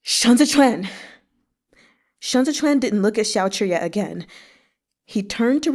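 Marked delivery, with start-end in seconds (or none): 0.0-0.9 louder, higher anguished howl of the name; enraged howl. Acted. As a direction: howl it like a wounded beast. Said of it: "Like a beast enraged, Xiao Chuye howled".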